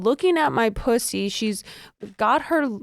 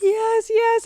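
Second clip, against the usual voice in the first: high, drawn mock cries; imitated screaming; 12 semitones higher than usual. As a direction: cry it out high, acting out ecstasy. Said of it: "And I'm screaming".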